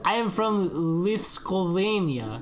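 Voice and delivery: drawn-out, hammed-up Lithuanian accent; bad Lithuanian accent. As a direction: put on a clumsy Lithuanian accent. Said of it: "That's my best accent".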